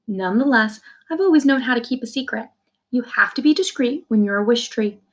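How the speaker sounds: plain reading voice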